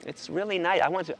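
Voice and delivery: ordinary talk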